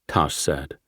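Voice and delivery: ordinary delivery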